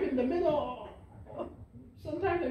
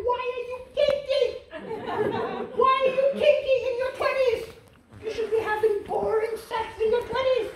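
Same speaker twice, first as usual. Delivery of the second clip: higher, louder incredulous scold; mock exasperated; 8 semitones higher, 5 dB louder than usual. Comic to young couple, higher exasperated scolding. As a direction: scold them in disbelief.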